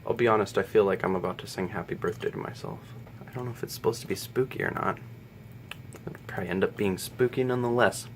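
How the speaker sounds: hushed in the dark. Real, not acted. Candle lit, whispering to viewers before a bedtime story. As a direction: keep it low like a dark room at night. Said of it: "with no lights on".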